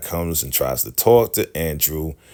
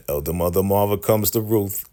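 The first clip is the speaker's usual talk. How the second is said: ordinary talk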